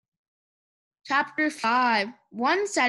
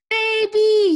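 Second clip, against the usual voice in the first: high, loud, drawn-out call; shouted; 9 semitones higher, 8 dB louder than usual. A narrator's reading. Eugenia calls for her missing sister Baby, shouted. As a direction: holler for someone missing. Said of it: "shouted Eugenia".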